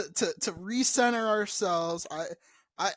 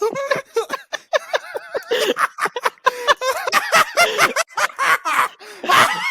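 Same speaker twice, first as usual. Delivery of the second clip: high squealing laugh; 8 dB louder than usual. Real, not acted. Uncontrollable laughter.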